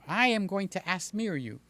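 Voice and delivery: usual speaking voice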